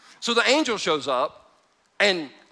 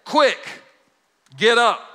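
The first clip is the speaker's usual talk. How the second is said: higher, louder, quick get up; urgent command; 5 semitones higher, 6 dB louder, slower than usual. Retold or imitated. Angel urgently wakes Peter, loud and sharp. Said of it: "and said".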